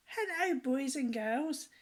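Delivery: high, loud cheery clown call; Humpty Dumpty greeting. A narrator's reading. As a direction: call out a big jolly hello to the children.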